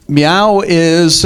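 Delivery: ordinary delivery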